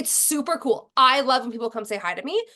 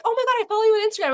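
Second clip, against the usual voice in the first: imitating eager fan; 10 semitones higher, 4 dB louder, much faster than usual.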